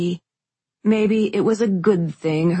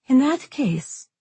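resigned; 8 semitones higher, slower than usual. A narrator's reading. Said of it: "tone final and shoulders sagging".

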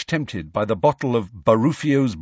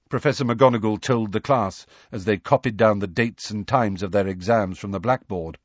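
usual speaking voice

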